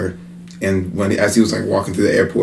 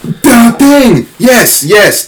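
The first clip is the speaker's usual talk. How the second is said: excited; 13 semitones higher, 8 dB louder, much slower than usual. Real, not acted. Excited recall of topic.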